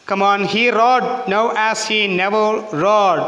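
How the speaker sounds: slow rhythmic line, strong beats landing on "rode" and "never"; stressed poem recitation. Shown as a demonstration. Teacher models the stanza's rhythm for students, slow with stressed "rode". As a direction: recite slowly, punching each "rode". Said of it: "stress ചെയ്യേണ്ടതാണ്".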